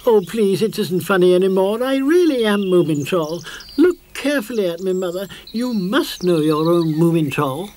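high, loud, fast desperate plea; pleading. Acted. Moomin Troll begs Moomin Mama to recognise him, pleading. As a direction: beg her to believe you.

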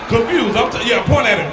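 normal talking voice

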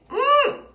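loud high squeal of dismay. Real, not acted. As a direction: squeal in embarrassment. Reacting to poofy bangs.

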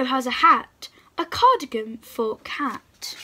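slow, gentle picture-book read-aloud; reading to children. Acted. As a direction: read each rhyme slowly, pausing between pages.

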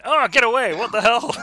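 high, very loud recoil; mock horrified shout. Acting a brother repelling his grown-up little sister, mock shout. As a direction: yell it in hammed-up alarm.

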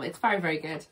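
ordinary delivery

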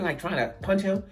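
normal talking voice

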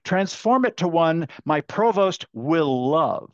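deliberate prompt with a weighty "transform"; stress on TRANSFORM. Shown as a demonstration. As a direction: stretch it out, leaning on "transform". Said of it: "emphasis on the verb transform".

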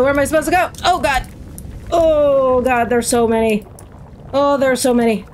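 ordinary delivery

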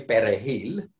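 perejil with a native Spanish R; Spanish pronunciation. Shown as a demonstration. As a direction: say perejil as a Spanish speaker would, R pronounced clearly. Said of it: "you can tell that I'm Spanish".

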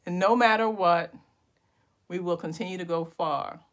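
slow, drawn-out poem recital with long pauses; spoken-word poetry. Pretend. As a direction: make up a poem line by line, savouring each phrase. Improvised poem resumes after laughter.

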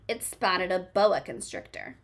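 ordinary delivery, nothing marked

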